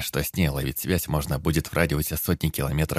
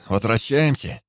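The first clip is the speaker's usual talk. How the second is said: raised voice over a roaring engine; shouting over engine noise; 5 semitones higher than usual. A narrator's reading. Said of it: "Перекрикивая нарастающий гул".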